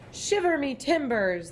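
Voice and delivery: high, hearty pirate exclaim. A narrator's reading. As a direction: ham it up like an old sea dog. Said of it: "the pirate said".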